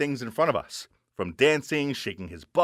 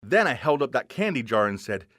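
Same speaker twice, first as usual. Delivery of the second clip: ordinary delivery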